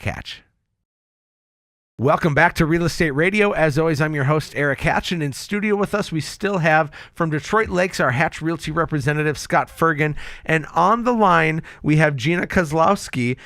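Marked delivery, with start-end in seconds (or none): 2.0-13.4 upbeat radio host welcome-back read; bright on-air announcer intro, projected. Acted. Radio host opening after break.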